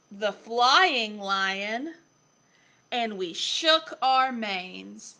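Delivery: slow, emphatic list with drawn-out endings; picture book reading. A narrator's reading. Reader listing lion stretches to a child, slow animated storytelling. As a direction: stretch each item out for a young listener.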